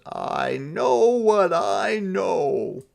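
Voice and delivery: slow, high and firm; slow stubborn insistence; stubborn Santa. Acted. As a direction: dig in and refuse.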